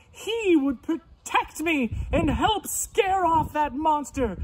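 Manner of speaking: high squeals easing into a pleading wail; acted frightened scream. Missus Pig sees a monster at her door, theatrically scared. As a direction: shriek and fret like a storybook pig. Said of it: "she was so scared, she screamed".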